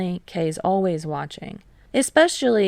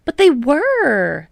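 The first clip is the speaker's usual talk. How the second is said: loud, slow, higher protest; 8 semitones higher, 8 dB louder, slower than usual. A narrator's reading. Tadashi defending the seniors to Kei, loud protest. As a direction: insist it, loud and high. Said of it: "Tadashi protests".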